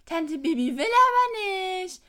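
mock Bibi pouting like a toddler; stretched-out pouty refusal; whiny little kid. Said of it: "Und dann antwortet Bibi so".

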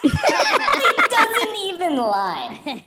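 high and slow, breaking into laughter; can't believe he said it, laugh through it; laughing, slow and high in disbelief. Real, not acted.

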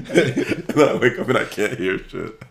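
high, loud laughing through the words; giggling punchline. Real, not acted.

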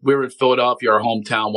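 ordinary delivery

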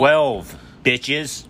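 excited cheer. Real, not acted. Cheering twelve followers.

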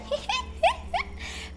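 high-pitched mischievous titter; risitas de niños imitadas. Retold or imitated. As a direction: imitate a kid's sneaky giggle. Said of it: "abrí los ojos y escuchar". (risita aguda e infantil)

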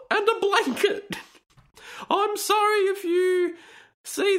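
sanctimonious falsetto parody; mocking high reading voice. Reading the post in put-on voice.

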